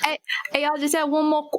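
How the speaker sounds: high-pitched British attempt; bad British accent. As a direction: put on a clumsy British voice. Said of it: "my really terrible British accent".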